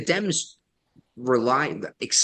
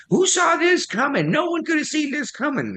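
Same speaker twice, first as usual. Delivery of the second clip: shrill mocking falsetto, much higher. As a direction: sneer it up high like a know-it-all. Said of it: "And everyone".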